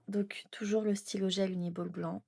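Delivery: whispered aside about the pen; half-whispered product note. Real, not acted. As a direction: breathe it, no voice. Reading out her pens to camera.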